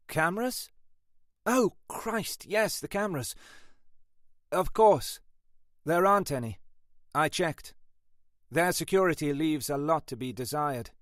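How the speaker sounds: high-pitched, caught-out junior; flustered sergeant. A narrator's reading. Sergeant reporting on cameras to boss, high and flustered.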